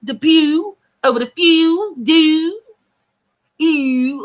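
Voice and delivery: mocking the Baltimore ew sound; mock Baltimore accent. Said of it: "That Baltimore accent with the ew".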